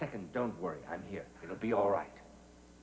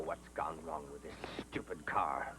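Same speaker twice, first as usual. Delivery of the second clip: muttered whisper; 7 semitones lower, 9 dB quieter than usual. Acted. Grumbling alone at broken car.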